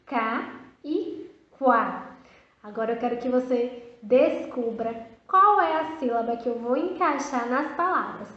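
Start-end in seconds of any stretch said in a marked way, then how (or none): 0.0-2.1 Cá e quá slowly, ka then kwa; slow contrast demo. Shown as a demonstration. Teacher contrasting ka and kwa for first graders. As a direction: set the two syllables apart. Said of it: "Viu só como é bem diferente?".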